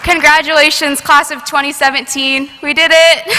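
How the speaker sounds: rally the whole class with joy; cheering shout. Real, not acted. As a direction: valedictorian shouting congratulations to her classmates.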